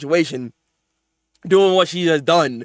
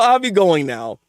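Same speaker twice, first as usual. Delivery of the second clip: ordinary talk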